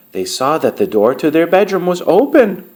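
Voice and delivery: ordinary delivery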